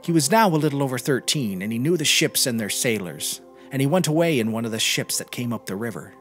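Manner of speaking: plain reading voice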